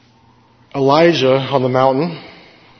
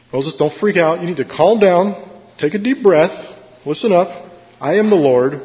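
high, louder firm command to calm down; firm God calming Moses; 7 semitones higher, 4 dB louder than usual. Retold or imitated. God telling a panicking Moses to calm down, firm and raised. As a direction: snap him back to reality. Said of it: "jolting back to reality for Moses".

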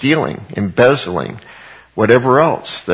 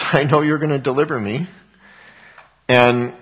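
ordinary talk

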